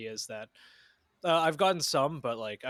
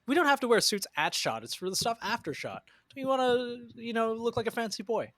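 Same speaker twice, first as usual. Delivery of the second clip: teasing; 9 semitones higher than usual. Real, not acted. Teasing friend about suits.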